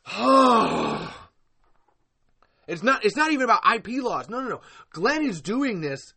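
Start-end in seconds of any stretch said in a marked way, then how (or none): 0.1-1.1 loud, long exasperated groan; frustrated groan. Real, not acted. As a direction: groan out pent-up anger. Groans in frustration mid-rant.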